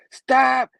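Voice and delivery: loud, high, abrupt command; acted high shout; shouted stop. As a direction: yell it to halt a fight. Character shouts stop mid-fight.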